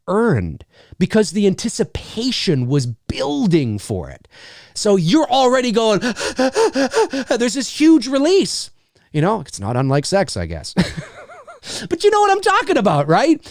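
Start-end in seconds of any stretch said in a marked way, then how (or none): 6.0-7.3 quick rising gasps; acted breathless gasping; anticipatory panting gasps. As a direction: gasp repeatedly with mounting excitement. Acts out mounting anticipation.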